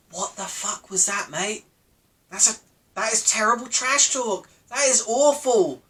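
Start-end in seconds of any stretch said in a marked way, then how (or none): none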